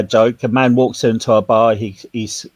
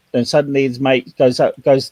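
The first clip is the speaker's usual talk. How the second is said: normal talking voice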